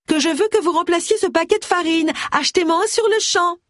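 high-pitched exasperated cry, no extra volume. A narrator's reading. Widow chasing the borrower into the grocery, crying out high. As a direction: raise the pitch sharply, insisting. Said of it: "cria celle-ci".

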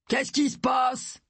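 slow, high demanding call. A narrator's reading. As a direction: call out to the whole house. Man calls out, alarming the listener.

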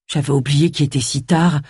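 ordinary delivery, nothing marked

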